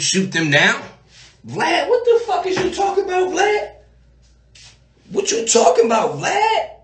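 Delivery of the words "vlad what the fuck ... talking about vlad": high, far-off incredulous squawk; pitched-up mock disbelief; mock outrage. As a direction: act baffled and offended. Mocks reply to cop question.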